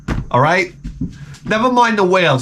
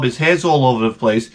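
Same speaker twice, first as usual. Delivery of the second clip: usual speaking voice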